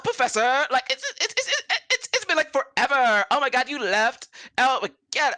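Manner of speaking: put-on high, loud valley-girl gushing; gushing elf singer. Elf singer greets her old professor, gushing high and loud. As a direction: squeal at an old teacher you adore. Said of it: "hear the elven lady".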